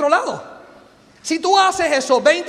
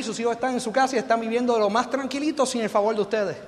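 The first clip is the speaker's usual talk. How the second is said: usual speaking voice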